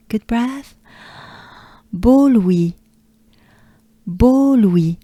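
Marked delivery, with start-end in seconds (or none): none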